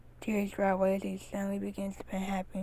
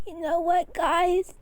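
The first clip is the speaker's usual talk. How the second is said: choking up; 9 semitones higher, 4 dB louder, much slower than usual. A narrator's reading. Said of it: "begins to choke up".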